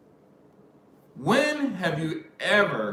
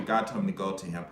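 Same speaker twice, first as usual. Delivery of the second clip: ordinary talk, nothing marked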